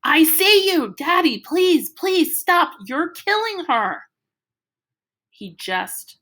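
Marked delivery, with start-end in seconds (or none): 0.0-4.0 high, loud pleading yell at father; desperate shout. A narrator's reading. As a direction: scream it to make him stop. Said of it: "I'm going".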